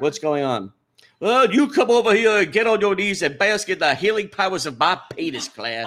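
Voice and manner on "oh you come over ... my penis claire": loud hammed-up Southern preacher; Southern accent. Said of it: "That accent is making me uncomfortable".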